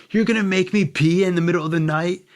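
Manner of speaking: put-on high, whiny outraged teen voice. Teen son whining at his mom in the middle of the night. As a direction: whine it like a wronged kid.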